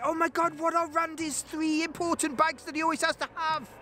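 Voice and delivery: very high-pitched mock voice; excited paparazzi. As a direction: do a silly, squealing paparazzo far above your own pitch. Mimics paparazzi spying from planes overhead.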